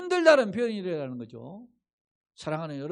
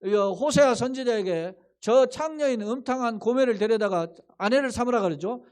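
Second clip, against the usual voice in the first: usual speaking voice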